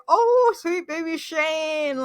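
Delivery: high, slow, pitying coo; high, slow sympathetic coo; aww, sweet baby. Retold or imitated. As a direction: coo over him like a hurt puppy. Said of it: "And I'm like".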